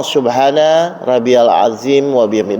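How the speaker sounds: unhurried, heartfelt glorifying; with praise. Shown as a demonstration. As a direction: recite it slowly as real praise of God. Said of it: "Dengan rasa memuji".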